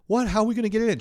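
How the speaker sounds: high, loud comic alarm; alarmed disbelief. Retold or imitated. Comic reacting to girlfriend's couch purchase, alarmed. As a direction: act flustered and incredulous. Retells panic about couch.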